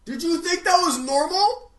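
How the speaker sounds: ordinary talk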